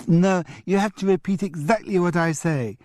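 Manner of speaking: put-on high childish parroting; mocking echo. Julian mimicking his grandfather in a high child voice. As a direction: echo grandad back like a cheeky kid. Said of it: "repeated Julian, who was catching on".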